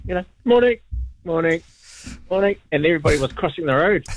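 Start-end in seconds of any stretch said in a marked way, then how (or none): 0.5-2.6 bright greetings fading off; cheery greeting. Retold or imitated. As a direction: call out friendly mornings. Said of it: "saying good morning to everybody".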